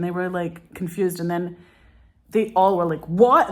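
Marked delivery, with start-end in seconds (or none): 3.1-3.5 loud, high, rising what; hammed-up incredulous outburst; mock bafflement. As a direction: imitate their stunned confusion, full volume. Acting the directors' confused reaction.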